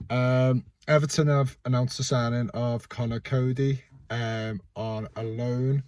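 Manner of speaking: normal talking voice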